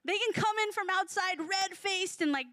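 normal talking voice

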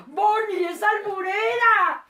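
loud, high mock-outraged cry; high, slow teasing shout; playful scolding. Real, not acted. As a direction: call her out at full voice, drawing it out. Playfully accuses co-host of innuendo.

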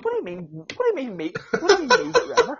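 incredulous, laughing, pitch shooting up; rising disbelief. Retold or imitated. Retelling disbelief at friend's request.

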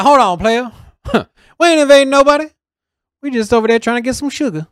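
high, loud, fast put-on voice with a laugh; mock Russia voice. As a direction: ham up a goofy Russian talking big. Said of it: "I don't know if that's what they sound like".

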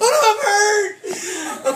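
high, very slow, loud mock cry; anxiety and fear voice. Anxious thoughts over an injured foot, exaggerated wailing cry. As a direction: howl it in hammed-up distress. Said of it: "That thing is all anxiety, all fear".

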